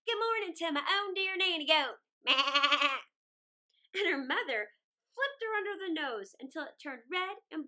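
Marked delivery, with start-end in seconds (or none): 0.1-2.0 louder mischievous greeting; a naughty girl teasing mother; cheeky teasing. Acted. 2.2-3.0 loud mischievous bleat-like laugh; a wild girl cackling; cheeky laugh. Acted. Girl laughs after teasing.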